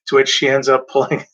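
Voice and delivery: ordinary talk, nothing marked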